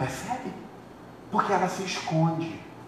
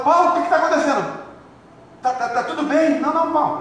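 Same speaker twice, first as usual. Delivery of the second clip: mock alarmed questioning; 9 semitones higher, 3 dB louder, faster than usual. Acted quarrel in the church.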